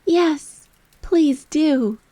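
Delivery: Alice pleads with the Dormouse, bright and high; pleading. A narrator's reading. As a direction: beg sweetly and eagerly. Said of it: "pleaded Alice".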